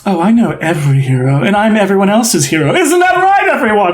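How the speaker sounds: theatrical brag climbing to a call-out; boastful flamboyant host. Acted. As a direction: build from smug aside to high rallying cry. Host Tullus boasts to crowd.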